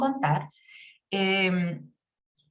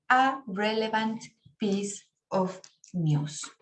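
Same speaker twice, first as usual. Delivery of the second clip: very slow, careful "A relevant piece of news"; slow model reading; 3 semitones lower, much slower than usual. Shown as a demonstration. Teacher reading the corrected example very slowly to students. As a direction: spell it out slowly so the class hears the a. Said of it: "inmediatamente cambia".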